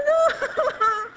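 high, loud wail breaking into laughter; mock wail. Woman retelling hugging herself alone at New Year's, loud mock crying. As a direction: wail in self-pity, half laughing.

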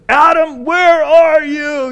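loud, high shouted challenge; booming, drawn-out police shout; arresting police officer. Shown as a demonstration. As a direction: shout it like a cop hunting a suspect. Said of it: "the cry of an arresting police officer".

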